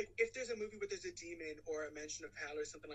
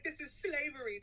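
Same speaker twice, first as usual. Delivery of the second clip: higher, louder mock indignation; mock outrage; 9 semitones higher, 5 dB louder than usual. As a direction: imitate an outraged crusader, pitched up. Acted quote of outraged character.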